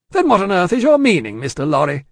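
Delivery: high, loud indignant question; blustering, baffled challenge; exasperated demand. A narrator's reading. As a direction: demand an explanation forcefully. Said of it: "demanded Stryver".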